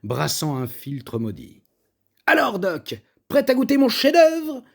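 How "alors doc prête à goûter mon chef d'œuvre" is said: bright, high, bubbly offer, louder at the end; sparkling teasing challenge. A narrator's reading. Cook teasing her guest to taste, bubbly and high. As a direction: fizz with mischief as you tempt him. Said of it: "sa voix pétillante comme un kombucha".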